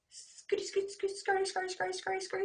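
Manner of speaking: high, quick scurry chant; scurrying spider. Acted. Teacher voicing a little spider scurrying. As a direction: patter like tiny legs.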